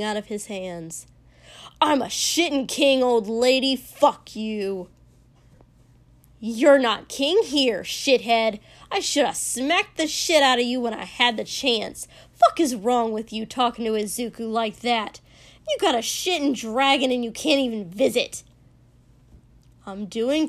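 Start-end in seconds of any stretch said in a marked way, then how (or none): 1.8-4.9 angry shout. A narrator's reading. Kotsky screaming match with old lady. 6.4-18.4 put-on loud, harsh old woman's yell; scolding old lady. A narrator's reading.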